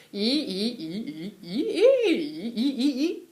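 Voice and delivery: "e" repeated, gliding upward from different pitches; playful climbing "e" demonstration; second tone sliding up. Shown as a demonstration. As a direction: slide each "e" up at once. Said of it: "it has to go up right away".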